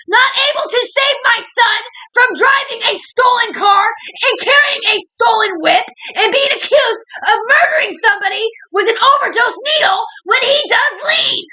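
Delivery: high screaming rant, sobbing through the words; all-out tearful yelling at those who wronged her; crying furious shout. Real, not acted. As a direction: scream it out in real tearful rage, pitch way up. Furious breakdown at mom, police.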